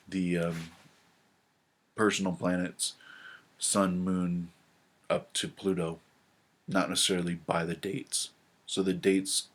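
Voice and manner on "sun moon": ordinary talk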